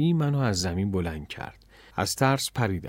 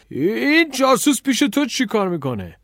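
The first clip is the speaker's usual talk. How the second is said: high, loud outraged yell; roaring; 11 semitones higher, 6 dB louder than usual. A narrator's reading. Café owner spots the stranger, outraged roar. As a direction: bellow an accusation. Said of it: "نره زد".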